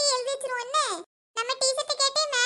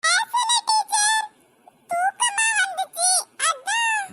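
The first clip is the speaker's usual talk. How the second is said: high drawn-out whine to the teacher; whiny pleading; 8 semitones higher, 5 dB louder, slower than usual. Acted. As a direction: plead innocence, stretching the words. Pupil caught talking by teacher.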